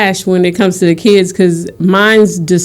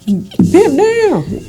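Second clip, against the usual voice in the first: laughing; 11 semitones higher, 3 dB quieter than usual. Real, not acted. Laughing, other cohost teasing.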